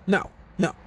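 sharp high no, no, no; flat denial. Retold or imitated. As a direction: shoot the idea down hard. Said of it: "I'm like".

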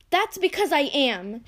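furious sister roaring her answer. Acted. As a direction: let the fury out on every word. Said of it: "she roared".

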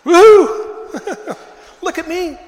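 high, loud whoop with a laugh; joyful shout. Acted. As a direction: shout for joy like a man who just walked. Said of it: "this guy's like".

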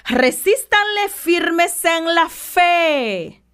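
preacher drives the verse home slowly; repeating the verse slowly so the flock listens; slow emphatic repeat. Shown as a demonstration. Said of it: "Oiga bien".

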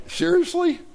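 very high-pitched mock disbelief; incredulous. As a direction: imitate people who can't believe their ears. Acts out listeners' disbelief at boast.

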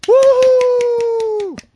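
high, loud whoop; excited cheer. Real, not acted. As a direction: whoop like the crowd went wild. Cheering episode start.

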